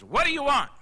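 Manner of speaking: startled man hollering at the door; loud, high holler. Retold or imitated. Stranger at the door hollers at the salesman. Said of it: "slings the screen door open and hollers".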